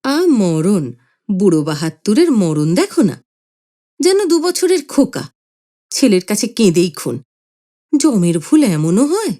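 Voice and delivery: mild mocking scolding; wife sneering at the old man; a touch of contempt; scornful. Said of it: "মা অমনি ... বলে উঠলেন".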